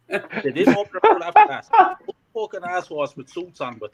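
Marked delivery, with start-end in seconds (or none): 0.1-2.0 higher, louder, laughing through it; laughing as he finishes the story; laughing while telling. Real, not acted. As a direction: laugh out the punchline of your own story. Laughing at his own story.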